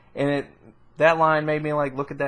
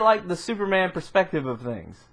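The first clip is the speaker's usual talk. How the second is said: normal talking voice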